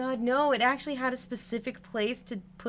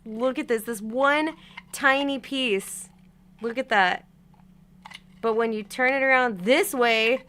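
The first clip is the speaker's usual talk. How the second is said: exasperated loud; 4 semitones higher, 9 dB louder than usual. Real, not acted. Showing tiny piece.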